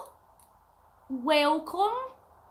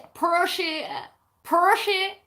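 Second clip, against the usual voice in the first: attempted imitation of a friend's accent, pitched up; higher, punchy go at a friend's accent; Mel's accent; 5 semitones higher, 5 dB louder than usual. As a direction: badly imitate your friend's accent, a bit loud. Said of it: "Or as Mel said".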